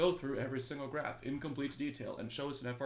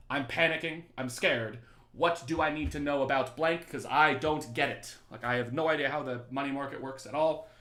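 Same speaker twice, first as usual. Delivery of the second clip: louder, hammed-up frightened student; mock panic; 2 semitones higher, 7 dB louder than usual. Teacher voicing a panicking student before the exam, mock frantic. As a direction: play the panicked student half in jest.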